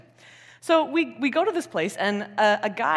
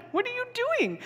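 much higher, pleading disbelief; exasperated hushed yell. Retold or imitated. Tourists plead with a woman near the rhino, high and alarmed. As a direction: squeak out your alarm at her. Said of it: "quietly yelling at her".

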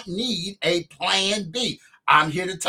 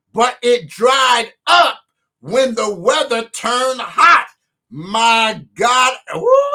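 loud fervent preaching; preaching at full force; 4 dB louder than usual. Acted. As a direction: lift it up and shout it out. Preacher builds to climax.

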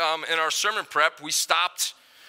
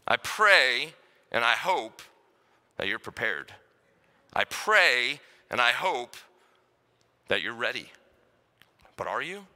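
earnest pastoral appeal; 6 semitones lower than usual. Acted. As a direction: plead gently with the listeners.

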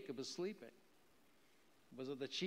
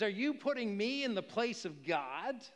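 angry Jacob; 7 semitones higher, 7 dB louder than usual. Acted. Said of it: "he gets angry about this".

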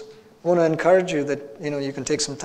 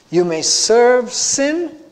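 "serve sin" drawn out slowly, loud, rising high; stress on SERVE; 8 semitones higher, 7 dB louder, much slower than usual. Real, not acted. Preacher sets serving sin against serving the Lord, emphatic. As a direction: stretch SERVE and lift "sin" into a suspended rise.

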